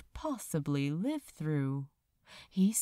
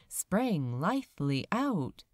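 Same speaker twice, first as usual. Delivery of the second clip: usual speaking voice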